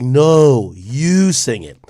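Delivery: drawn-out NO, loud stretched YOU; retold friendly argument, stressing YOU; playful insistence. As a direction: insist with a long, swooping "you". Acted back-and-forth over who sings.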